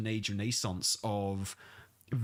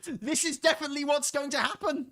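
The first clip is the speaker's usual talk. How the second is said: mock certainty; 11 semitones higher than usual. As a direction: play the overexcited fan, totally sure. Mocking community certainty.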